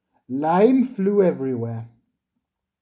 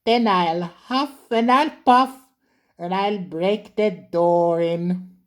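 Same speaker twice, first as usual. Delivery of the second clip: high, hammed-up wolf threat; big bad wolf; 3 semitones higher than usual. A narrator's reading. Percy playing the wolf at the shed door, hammed-up threat. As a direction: huff and puff like the wolf.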